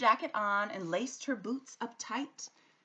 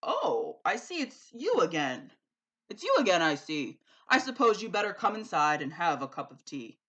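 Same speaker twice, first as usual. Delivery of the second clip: booming giant, louder; calling giant; 10 dB louder than usual. A narrator's reading. The giant greets Sophie at his door. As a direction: call out then grudgingly welcome her. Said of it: "The giant called".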